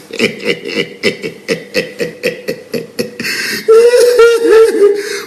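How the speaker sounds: long staccato laugh climbing very high; real cackling fit, rising into a high wheeze; helpless laughter. Real, not acted. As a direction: crack up completely. Cracking up at his own remark.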